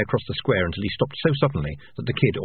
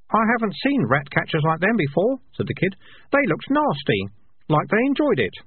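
put-on higher, young boy voice; kid voice; 11 semitones higher than usual. A narrator's reading. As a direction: voice the kid, up high.

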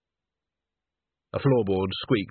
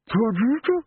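muffled through heavy cloth; 12 semitones higher, 3 dB louder than usual. A narrator's reading. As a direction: speak as if under a curtain. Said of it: "said a muffled voice".

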